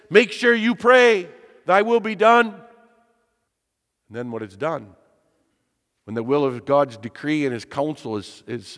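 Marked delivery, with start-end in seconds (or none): 0.1-2.5 emphatic command. Acted. God charging believers to pray, emphatic command. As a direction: drive the command home, voice lifted high and loud. God's command voiced in sermon.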